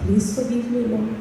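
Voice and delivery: low, soft imitation of tearful begging; half-hearted tearful plea, low and soft; mock crying. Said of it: "and then I cry".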